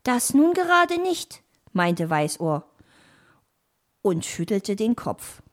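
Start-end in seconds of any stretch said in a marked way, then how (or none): 0.0-1.4 put-on high young-animal voice; young fox. Nephew contradicts his fox uncle, high young voice. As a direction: play the little nephew, pitched up.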